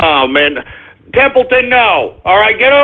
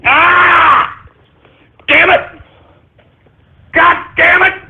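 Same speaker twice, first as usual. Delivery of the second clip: pained angry cursing; 6 semitones higher, slower than usual. Real, not acted. Host yelping and cursing as the dog snaps, real pain. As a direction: cry out then curse, pitched higher.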